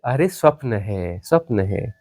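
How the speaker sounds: soft, low puncturing of a proud claim; quiet dismissal. Real, not acted. Teacher telling a seeker it is only a dream, quiet dismissal. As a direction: drop low and soft, unhurried.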